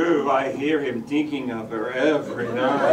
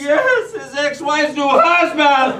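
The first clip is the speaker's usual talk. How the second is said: higher, louder hammed-up sob-speech; sobbing; 11 semitones higher, 10 dB louder, slower than usual. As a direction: blurt it through mock tears. Answering while sobbing.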